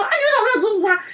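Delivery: high, fast mock scolding; 模仿妈妈责骂. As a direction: demand an answer like an angry mom. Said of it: "他骂我为什么没有阻止他". (学妈妈又高又快地责问)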